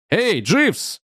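high-pitched call without real volume. A narrator's reading. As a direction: call after the butler, voice lifted. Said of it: "крикнул я". (окликнуть повыше, негромко)

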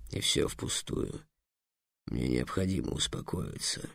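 a dragon curled up, calming itself; hushed dragon. A narrator's reading. (очень тихо, почти шёпотом, низко)

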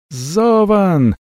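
high call from far off; distant cry. A narrator's reading. Avella calls Zovan from far away, high. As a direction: call his name across the field. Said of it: "донёсся издалека крик Авеллы".

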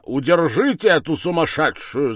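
high, loud call for restraint; angry shout. A narrator's reading. Accuser hit by the thrown banknote, shouting angrily. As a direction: yell out the order indignantly. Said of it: "закричал он".

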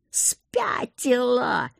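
drawn-out, strained half-voiced yell; enraged yell. A narrator's reading. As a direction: yell it hoarsely, stretching the word. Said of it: "проорал мне в лицо командор". (сдавленный протяжный крик)

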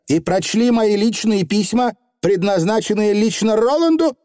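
high, outraged question; indignant interruption. A narrator's reading. Young witch cutting off the Feegle, indignant. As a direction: cut in with rising indignation. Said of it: "перебила Тиффани".

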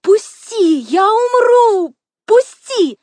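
very high, loud desperate scream; frantic, full-voiced shriek; shrieking plea. A narrator's reading. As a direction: scream to be let go. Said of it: "визжала".

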